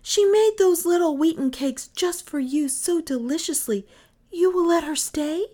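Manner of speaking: put-on high, eager children's plea; pleading children. A narrator's reading. Children pleading with mother to let Nan stay, eager. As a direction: coax mother into saying yes.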